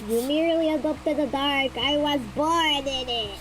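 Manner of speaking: slowed, lightly muffled Bane impression; a hint of the masked villain. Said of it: "that voice for Bane".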